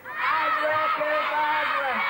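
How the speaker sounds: drawn-out loud shout. Real, not acted. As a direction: shout the magic word, slowly. Audience shouts magic word.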